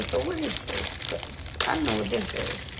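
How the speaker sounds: usual speaking voice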